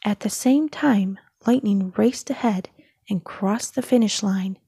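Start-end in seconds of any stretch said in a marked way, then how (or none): none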